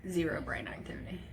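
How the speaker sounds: soft unvoiced whisper; stunned whisper. Real, not acted. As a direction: breathe the words out in shock. Repeats the news in a whisper.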